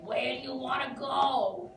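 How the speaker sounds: lightly imitated Bronx cabbie, drawn out slowly; mock Bronx accent, slow; thick Bronx accent. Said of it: "in his thick Bronx accent".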